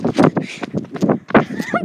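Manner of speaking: high airy cackle of disbelief; high breathless laugh. Real, not acted. Player laughing after nearly getting killed, high airy laugh.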